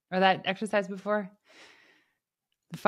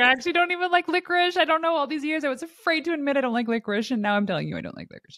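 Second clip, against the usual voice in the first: much higher, fast, giddy confession voice; mock confession; 8 semitones higher, faster than usual. Host mocking a giddy confession about licorice, high and fast. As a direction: blurt it out like a silly secret. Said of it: "It's like".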